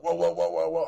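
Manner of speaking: high rising whoas; mock alarm. Reliving the roller grabbing his fingers, panicked whoas. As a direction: yelp whoa as fingers get pulled in. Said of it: "And I was like".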